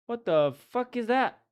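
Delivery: loud, high what the fuck; shocked outburst. Real, not acted. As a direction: recoil at something baffling. Shocked by strange page text.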